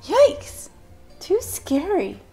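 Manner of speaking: high, startled yelp; scared cry. Acted. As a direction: squeal it in fright. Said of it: "she cried".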